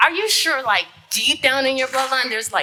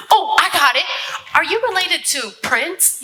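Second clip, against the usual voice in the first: excited realisation; 6 semitones higher than usual. Acted. Sudden realisation.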